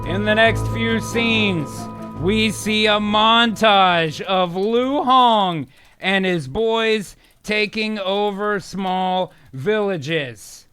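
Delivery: slow, word-by-word prompt dictation; deliberate, spaced-out phrasing. Real, not acted. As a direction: dictate at typing speed. Dictating next prompt while typing.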